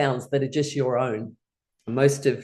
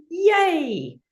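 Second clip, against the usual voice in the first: long, high squealed yay; childlike cheer; 14 semitones higher than usual. Shown as a demonstration. Teacher showing playful activity to class, childlike cheer. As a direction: cheer it out, silly and drawn out. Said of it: "A little bit childlike, silly, and fun".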